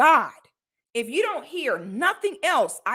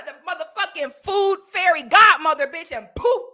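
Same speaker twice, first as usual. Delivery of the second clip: ordinary talk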